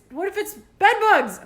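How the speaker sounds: loud, high alarm over bed bugs; panicked cry. Retold or imitated. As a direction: peak of the panic spiral. Spiralling panic in her head.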